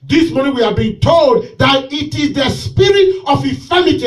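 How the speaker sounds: loud, driving preacher's call; fiery preaching. Real, not acted. Pastor preaching to his church, loud and driving. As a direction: preach it out to the congregation.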